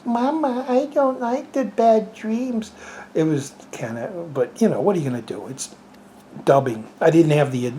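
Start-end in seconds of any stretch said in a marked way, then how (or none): 0.0-2.7 put-on high, slow dubbed little-girl voice; an adult faking a small child's voice; baby voice. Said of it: "an older person doing a little baby voice".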